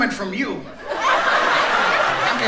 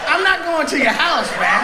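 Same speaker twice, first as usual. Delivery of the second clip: laughing shout; 4 semitones higher than usual. Real, not acted. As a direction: yell it while cracking up.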